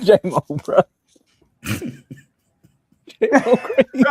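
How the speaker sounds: high, drawn-out words through real laughter; laughing. Real, not acted. To his cohosts about J Mo, laughing hard. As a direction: crack up at J Mo's comment. Cracking up at a comment.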